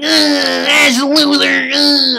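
a bleeding brat still taunting; squealing high cry of pain; pained Gary voice. Acted. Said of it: "be like".

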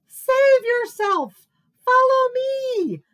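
high, loud drawn-out cry; all-out alarm call; panicked shout. A narrator's reading. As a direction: yell it slowly, stretching each word. Bear cries out fleeing monster.

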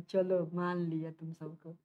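slow, low, soft concession; mock resignation. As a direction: sink into feigned surrender. Mock giving in to students' answers. (बनावटी हार मानते हुए)

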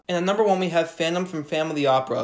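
ordinary talk

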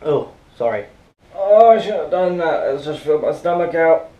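ordinary talk, nothing marked

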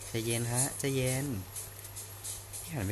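soft whispered calm-down; whispering. Real, not acted. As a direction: whisper it to yourself. Reacting to shouting outside.

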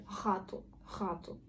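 ГАТУ with a breathy throat H for R; young people's R. Shown as a demonstration. Teacher shows youth pronunciation, ГАТУ with throaty H. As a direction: start ГАТУ on a soft back H, no trill. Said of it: "молодь буде говорити".

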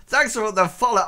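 ordinary talk

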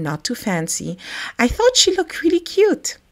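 usual speaking voice

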